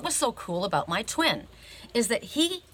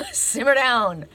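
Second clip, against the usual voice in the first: 1 imitated calming order; 3 semitones higher, 6 dB louder, slower than usual. Imitating friend telling her off.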